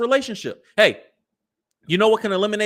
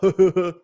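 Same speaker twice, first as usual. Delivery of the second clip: laugh; 4 dB louder than usual. Real, not acted. Laughs at the quoted joke.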